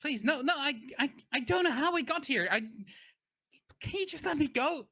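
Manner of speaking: high, fast, desperate begging; panicked captive pleading not to die. Acted. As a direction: beg for your life, voice way up.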